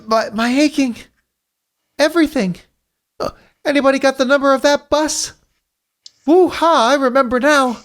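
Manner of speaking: high-pitched, groaning, dazed hero; groggy young Tails. Acted. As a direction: wake up sore and bewildered, voice pitched well up. Voices Tails in the story.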